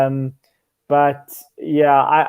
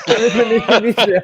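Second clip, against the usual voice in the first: laughing; 7 semitones higher, 3 dB louder than usual. Real, not acted. Laughing at being famous abroad.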